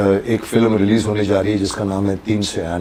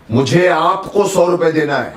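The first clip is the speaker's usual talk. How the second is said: slow, with सौ pressed and falling; stress on सौ; 5 semitones higher than usual. Shown as a demonstration. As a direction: dwell on सौ to set the amount. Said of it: "मतलब इनको नहीं देना है".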